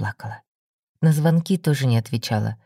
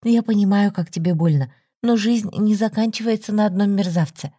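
higher, pressing concern; worried friend; 7 semitones higher than usual. A narrator's reading. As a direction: coax her to open up. Olga worried on phone.

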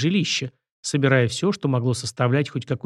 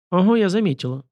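higher, playful knowing tone; sly amusement; 6 semitones higher, 3 dB louder than usual. A narrator's reading. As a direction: tease him slyly, no real laugh. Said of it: "лукаво рассмеялась Елена". (лукаво, с лёгкой насмешкой)

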